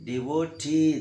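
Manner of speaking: "D O T" with the final T drawn out; stretched, emphatic T ending "D O T"; stress on last syllable. Shown as a demonstration. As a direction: make the T stand out in "D O T". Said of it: "That is the rising letter".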